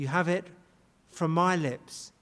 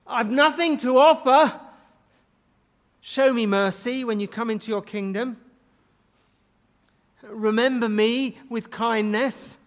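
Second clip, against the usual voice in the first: raised, earnest plea, slowing at the end; pleading for mercy; 3 semitones higher, 6 dB louder than usual. Retold or imitated. Dying thief pleading with Jesus for mercy, mildly acted plea. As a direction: beg him for mercy with nothing to offer. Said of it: "He's pleading for mercy".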